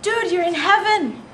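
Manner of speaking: mock disbelief at a crying man; incredulous guy. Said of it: "and he says".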